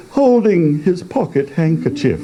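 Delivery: ordinary delivery, nothing marked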